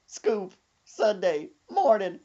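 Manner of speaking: ordinary talk, nothing marked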